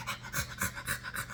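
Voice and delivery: quick breathy dog pants; voiceless, rapid panting like a dog. Retold or imitated. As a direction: imitate an excited dog's panting. Imitates dog in back seat.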